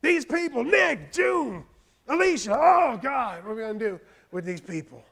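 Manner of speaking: high, loud shouted names; pastor's own vehement prayer cry; praying out loud. Shown as a demonstration. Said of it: "That's what I sound like when I pray".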